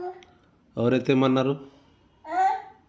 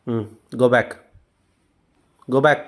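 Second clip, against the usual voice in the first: normal talking voice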